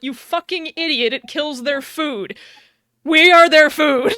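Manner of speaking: mock outrage building to a loud yell; retold angry yelling. Cortana berating the Master Chief about the Flood, angry yelling. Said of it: "she basically yells at you".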